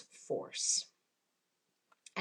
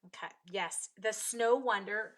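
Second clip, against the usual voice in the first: ordinary talk, nothing marked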